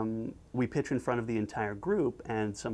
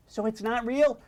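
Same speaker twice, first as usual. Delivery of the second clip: high-pitched hopeful question; naive cartoon listener; 10 semitones higher than usual. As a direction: ask in a high childlike cartoon voice. Acted naive listener asking.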